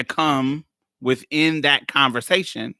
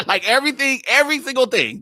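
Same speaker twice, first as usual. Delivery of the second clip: laughing; 9 semitones higher, faster than usual. Real, not acted. Laughing after absurd retort.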